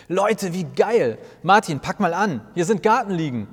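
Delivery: thrilled and loud, dragged out at the start; excited call. Acted. Jesus calls to his friends about the garden loungers, excited shout. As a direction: shout it with delight. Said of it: "ruft Jesus begeistert".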